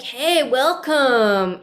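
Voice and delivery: slow, drawn-out laid-back welcome; a hint of a chilled-out host saying hey; mellow greeter voice. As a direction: imitate the bouncy hippie host, lightly. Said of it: "talking like this".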